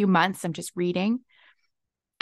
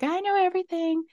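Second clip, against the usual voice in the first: pitched-up, teasing know-it-all line; mock proud boast; 9 semitones higher than usual. Telling listeners not to be proud, mock boast. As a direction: sing out the proud claim, poking fun. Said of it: "so proud and be like".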